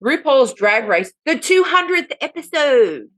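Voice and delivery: loud, drawn-out title announcement; grand mock-presenter voice; show announcer. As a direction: proclaim it slowly, rising high.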